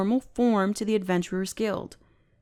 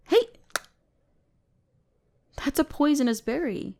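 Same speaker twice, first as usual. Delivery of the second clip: higher, urgent alert; alarmed warning; 6 semitones higher than usual. Acted. Mage warning the human about a poisonous berry, alarmed. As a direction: stop them from eating it.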